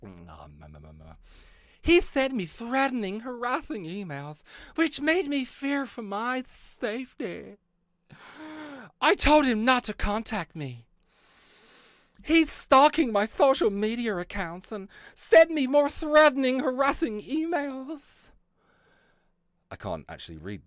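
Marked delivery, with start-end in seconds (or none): 0.0-1.1 vocal warm-up. Shown as a demonstration. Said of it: "get my Karen voice on". 1.8-18.3 high, whiny, slow entitled complaining; Karen voice. Retold or imitated. Mocking the complainant's letter in a Karen voice. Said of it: "I need to get my Karen voice on".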